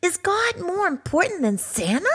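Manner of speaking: a small cub asking earnestly; high excited cub voice; curious little cub. Acted.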